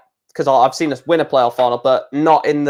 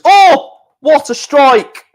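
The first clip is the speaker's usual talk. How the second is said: high, loud gasp of amazement, then drawn-out words; real commentator's awe, pitched high and slowing; excited outburst; 11 semitones higher, 7 dB louder, much slower than usual. Real, not acted. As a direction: burst out at a thrilling shot. Commentator reacts to near goal.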